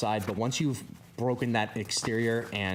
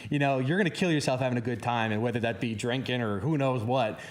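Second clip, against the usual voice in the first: ordinary talk, nothing marked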